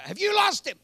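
put-on wife suddenly realising the boy is lost, alarmed. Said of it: "She goes".

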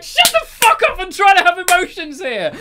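loud, very high shriek through laughter; hammed-up high-pitched scolding shout; retold outraged shout. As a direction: yell it in mock outrage, cracking up. Friend's retort, retold while laughing.

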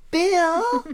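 high, loud call of "Bill". Pretend. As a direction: call Bill's name across a room. Calling the character's name.

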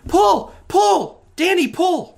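high urgent yells to pull; frantic shouting. Acted. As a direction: shout at Danny to pull hard. Frantic pulling on rope.

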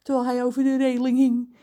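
ordinary delivery

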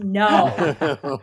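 loud laughing yell of disgust. Retold or imitated. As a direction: yell it at the screen in horror. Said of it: "I yelled".

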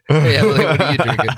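high, laugh-filled question; cracking up while moving on. Real, not acted. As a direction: ask it through laughter. Still laughing, moves to next host.

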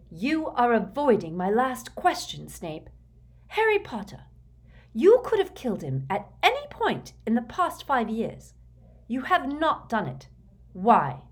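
high, demanding interrogator; accusing Bellatrix. A narrator's reading. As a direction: press an angry accusation. Voices Bellatrix interrogating Snape.

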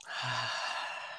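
long, soft sigh; low, hushed release of breath; meditation exhale. Shown as a demonstration. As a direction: breathe out slowly and quietly. Exhales during guided meditation.

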